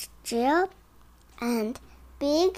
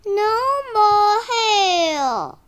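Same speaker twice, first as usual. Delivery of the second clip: whiny; 8 semitones higher, 6 dB louder, slower than usual. Acted. Tired characters complain.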